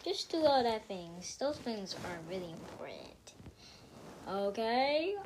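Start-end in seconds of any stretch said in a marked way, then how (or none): 1.4-3.3 whisper. Real, not acted. Whispered aside.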